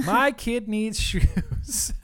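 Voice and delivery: high, slow echo through laughter; imitated mock wail. Host echoing co-host's plea, high laughing mock wail.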